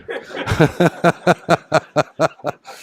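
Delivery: bright chuckle at his own story; a real laugh breaking out; pleased little laugh. Real, not acted.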